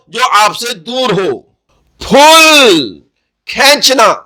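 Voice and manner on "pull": پھول stretched out very slowly; teacher modelling پھول; slow careful pronunciation. Shown as a demonstration. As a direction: draw out پھول for the class. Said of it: "اس کا تلفظ".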